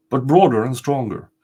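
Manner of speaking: plain reading voice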